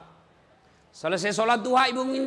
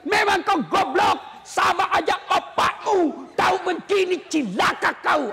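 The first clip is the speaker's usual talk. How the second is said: shouted mock insult, higher and slow; cursing the husband; 7 semitones higher than usual. Preacher acting a wife cursing her husband, shouted. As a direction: rant at him like an enraged wife. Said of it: "yang tidak boleh maki-maki suami".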